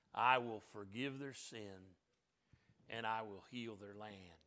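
slow, low, hushed recital with long pauses; solemn scripture recitation. Acted. Preacher reciting God's promise to his people, solemn and hushed.

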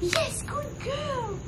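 very high, slow, sing-song praise; stretched-out, very high praise for the puppy. Real, not acted. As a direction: gush over the good dog in a squeaky voice. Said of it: "that specific tone".

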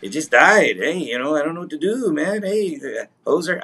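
attempted Canadian accent. Host plays clueless Marineland worker, attempted Canadian accent. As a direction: do a bad, cartoonish Canadian accent. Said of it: "how's the Canadian accent?".

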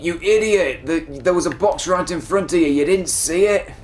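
put-on voice of a scolding viewer; mocking viewer voice. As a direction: imitate an indignant armchair critic. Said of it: "I'm sorry if anyone talks like that".

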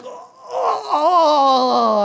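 long, high, loud disgusted wail; hammed-up groan of revulsion. Owner touches the filthy dog, disgusted groan.

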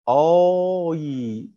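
"آي" held very long; drawn-out vowel. Shown as a demonstration. Teacher stretches the vowel of "boy" for pupils, very drawn out. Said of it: "نطقها سهل".

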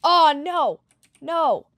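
loud panicked cry; panicked shout. Real, not acted. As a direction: yell it out in alarm. Caught, panicking.

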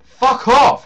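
high loud curse; angry shout. Real, not acted. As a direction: bark it out loud. Shouting at game.

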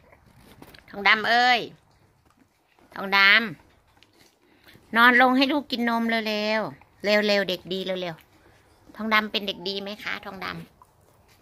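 very slow, drawn-out coaxing; coaxing drawl. Real, not acted. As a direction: stretch every word to coax the dog down. Coaxing the dog to lie down. (พูดลากยาวช้าๆ ปลอบหมาให้นอน)

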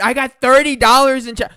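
usual speaking voice

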